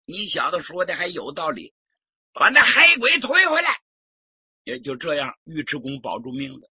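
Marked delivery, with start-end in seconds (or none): 2.3-3.8 high, loud command; loud order. Acted. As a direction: call the guards back. Prince ordering him brought back. (高声传令)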